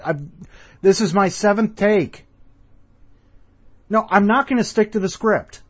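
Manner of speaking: mock complaint at his crew; exasperated. Mock spat with production team.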